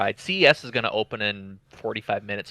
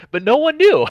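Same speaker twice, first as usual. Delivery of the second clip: gleeful; 10 dB louder than usual. Real, not acted. Laughing punchline of prank.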